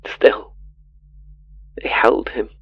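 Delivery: hushed whisper on held. A narrator's reading. Narrator holding the ghost's hand, whispering. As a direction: breathe the words out, voiceless.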